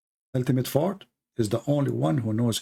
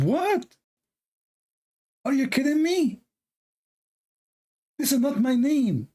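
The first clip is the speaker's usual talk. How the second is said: put-on much higher, outraged girl voice; shocked girl; 11 semitones higher than usual. The girl answering his cafeteria greeting, high shocked. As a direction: squeal it in disbelief. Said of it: "She said".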